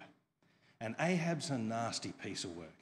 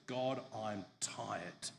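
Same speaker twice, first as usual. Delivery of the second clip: slow, softer, worn-out sigh of a line; weary despair; 3 semitones lower, 7 dB quieter, much slower than usual. Retold or imitated. Elijah telling God he is exhausted, slow and weary. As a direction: drag it out like he's done. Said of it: "he goes".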